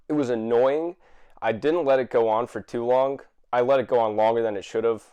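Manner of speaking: normal talking voice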